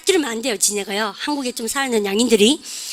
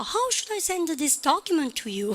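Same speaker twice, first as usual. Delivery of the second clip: high, soft, drawn-out whine; mock whining; 4 semitones higher, 7 dB quieter, much slower than usual. Teacher mimicking the English audio's tune, mock whining. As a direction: whine it slowly and higher. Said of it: "짜는 거 같죠?".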